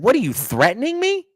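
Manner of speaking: high, disbelieving question; incredulous. Retold or imitated. To a coworker about to soil himself, incredulous. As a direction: ask it like you can't believe the threat. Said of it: "I looked at him and I said".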